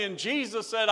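ordinary talk

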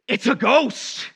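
acted high, loud, drawn-out alarm; terrified cry. Disciples looking at Jesus on the water, crying out in mock terror. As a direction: wail it slowly like a frightened witness. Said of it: "they're superstitiously terrified".

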